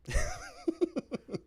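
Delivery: a sudden very high squeal of a laugh; high squeaky burst of laughter. Real, not acted. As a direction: crack up at the silly name. Laughing at the prank name.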